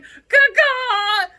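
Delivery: loud high caw-caw; yelling goat call. Pretend. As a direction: yell caw-caw at the goats. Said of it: "and I'm yelling".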